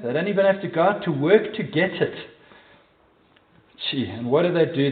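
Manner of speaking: normal talking voice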